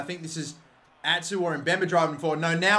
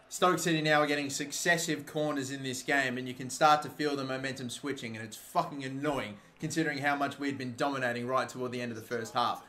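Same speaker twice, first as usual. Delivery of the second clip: ordinary talk, nothing marked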